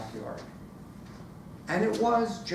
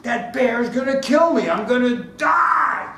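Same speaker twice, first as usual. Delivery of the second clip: loud, high terrified wail; hammed-up shout, dragging out the last words; mock panic; 8 semitones higher, 8 dB louder than usual. Storyteller running from the bear, mock terror.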